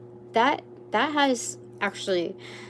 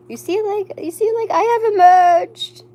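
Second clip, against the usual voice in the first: Archangel Michael; 12 semitones higher, 10 dB louder than usual. Pretend. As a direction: announce it like a being who just bloomed. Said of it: "Archangel Michael is basically doing that".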